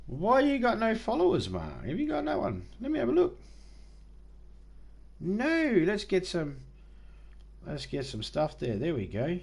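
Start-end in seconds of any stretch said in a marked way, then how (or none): 0.1-6.6 much higher-pitched, playful mock-incredulous voice; mock squeaky chiding. Streamer teasing a viewer with no followers, high mock-incredulous squeak. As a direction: tease them in a high, put-on squeak.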